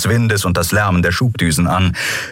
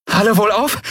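mild breathless, higher-pitched question; keuchend; 14 semitones higher than usual. A narrator's reading. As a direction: ask it as if catching your breath. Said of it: "fragte sie keuchend". (leicht keuchend, mit höherer Stimme)

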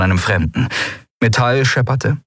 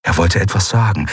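ordinary delivery

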